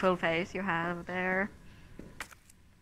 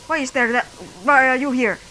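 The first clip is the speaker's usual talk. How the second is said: loud, high panicked yelling; startled panic, much higher and louder; alarmed shout; 5 semitones higher, 9 dB louder, faster than usual. Real, not acted. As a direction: yell at the zombies to back off. Panics at zombies.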